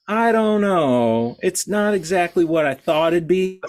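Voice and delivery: mock hesitant client, high and drawn out at first; dissatisfied client. Imagined client reacting to twelve hours of painting, mock dissatisfied. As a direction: draw out the high, doubtful opening like a hammed-up picky customer. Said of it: "And then you go".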